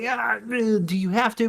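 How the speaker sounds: high, skeptical mock question; sarcastic high-pitched comeback; mock sarcastic reply. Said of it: "And it's like".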